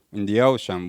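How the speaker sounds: ordinary delivery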